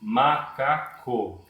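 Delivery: "macaco" slowly split as ma, ca, co; syllable by syllable. Shown as a demonstration. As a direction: say "macaco" in three long, separate syllables. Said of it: "O que que é falar silabicamente?".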